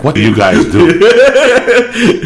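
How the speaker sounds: high, loud, slowed mock disbelief; mock bewildered question. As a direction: stretch it out, baffled and amused. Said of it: "and you're like".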